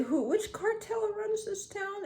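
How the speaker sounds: high, soft put-on voice asking innocently; mock naive question. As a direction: play dumb, feigning innocence. Retold dream question to police.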